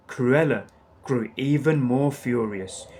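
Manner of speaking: plain reading voice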